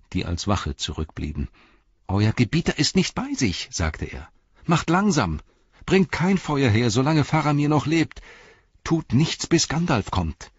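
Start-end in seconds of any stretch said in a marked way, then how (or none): 2.1-3.7 high-pitched urgent warning; anxious hobbit voice; urgent Pippin. Acted. Pippin urgently warns a servant. 4.7-10.5 high, insistent pleading orders; emphatic high hobbit voice; urgent Pippin. Acted.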